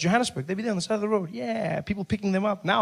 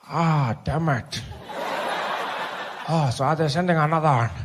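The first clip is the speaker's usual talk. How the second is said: put-on old Mandela; 3 semitones lower than usual. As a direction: sigh it out like a tired elder.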